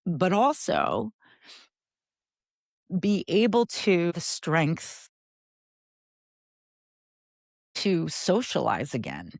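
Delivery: fighting to get the words out through real grief; holding back tears. Real, not acted. Grief over losing her mother.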